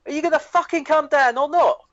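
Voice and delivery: high-pitched imitated yell across a crowd; retold shout. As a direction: call it out like an impatient shout down the line. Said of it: "he shouts over".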